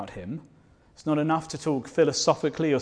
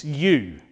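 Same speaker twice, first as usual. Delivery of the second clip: you punched and louder; stress on you; 6 dB louder than usual. Shown as a demonstration. Preacher to congregation, the word you punched hard. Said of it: "the Greek is really emphatic".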